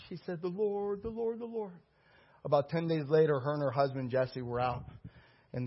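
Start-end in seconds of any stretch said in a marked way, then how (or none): 0.4-1.7 imitated hushed awe. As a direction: breathe out her wonder quietly, lifting in pitch. Acts out woman's awed response.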